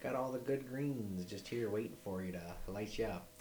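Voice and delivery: lightly voiced friendly Canadian dude; brisk, chummy Canadian clerk; Canadian accent. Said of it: "And they're like".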